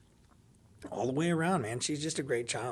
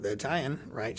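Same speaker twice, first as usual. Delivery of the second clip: usual speaking voice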